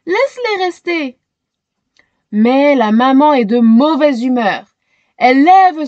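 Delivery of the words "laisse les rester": higher, gentle begging; pleading girl. A narrator's reading. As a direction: plead to keep them. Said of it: "supplie Shéna".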